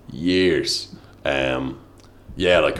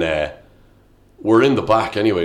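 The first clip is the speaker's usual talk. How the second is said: ordinary talk, nothing marked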